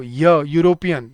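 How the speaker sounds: "यूरोपियन" said slowly, leaning on the y glide; drawn-out y sound. Shown as a demonstration. Teacher sounding out the y for students. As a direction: stretch the "य" at the start. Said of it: "बोल के देखो".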